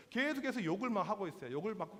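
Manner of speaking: ordinary delivery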